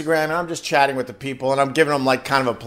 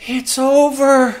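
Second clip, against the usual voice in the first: mock despair; 10 semitones higher, 5 dB louder, slower than usual. Parent dreading Christmas end.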